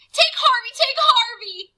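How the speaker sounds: high, loud frantic pleading; boy sobbing to the vampire; sobbing plea. Acted. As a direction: offer up your friend in tears. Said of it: "he sobbed".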